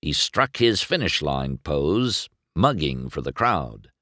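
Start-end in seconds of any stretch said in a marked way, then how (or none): none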